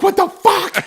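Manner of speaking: high-pitched incredulous outburst; exasperated disbelief. Retold or imitated. Son to mental-case dad on stairs, exasperated. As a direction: imitate being utterly baffled.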